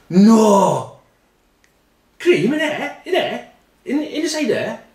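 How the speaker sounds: high, giggly disbelief; high laughing gasp of wonder; mock amazement. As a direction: squeal it like you can't believe it. [name] amazed at cream inside.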